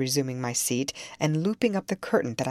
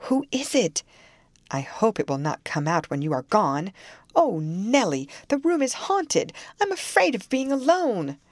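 frightened; 5 semitones higher, 3 dB louder than usual. A narrator's reading. Catherine frightened by the mirror.

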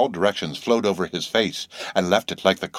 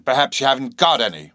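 plain reading voice

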